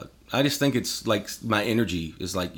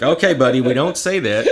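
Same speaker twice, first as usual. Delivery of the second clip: louder, sing-song parental correction; mock parent scolding; 3 semitones higher, 5 dB louder than usual. Father telling young son not to swear. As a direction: gently correct the kid.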